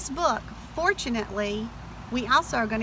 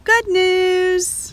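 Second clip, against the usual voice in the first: bright cheerful good news, pitched up; happy; 8 semitones higher, 5 dB louder than usual. Shown as a demonstration. Teacher reading to children, delighted. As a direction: cheer it like a gift.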